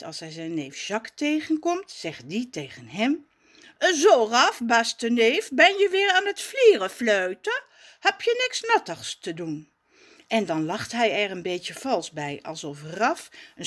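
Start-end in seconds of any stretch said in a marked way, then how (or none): 3.8-9.7 higher, louder teasing cousin voice; mocking posh cousin. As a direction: sneer at the lazy little cousin. Cousin Jacques teasing Raf.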